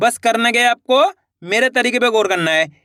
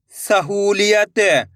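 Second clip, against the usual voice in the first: syllables joined into one slow word; blended word; much slower than usual. Shown as a demonstration. Teacher joining the syllables for learners, slowly blended. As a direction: read it as one whole, stretched word. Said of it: "इनको आपस में लाकर पढ़ना है".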